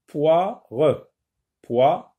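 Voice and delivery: "poire" in two beats, poi - re; split into syllables. Shown as a demonstration. Teacher counting syllables, "poire" broken in two each time. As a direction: sound the final re of "poire" as its own syllable. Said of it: "Deux syllabes".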